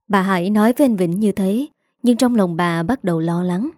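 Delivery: ordinary delivery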